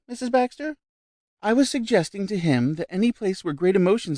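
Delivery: high, lively chatter; lightly voiced vivacious society lady. A narrator's reading. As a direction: bubble over with news. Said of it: "went on the other vivaciously".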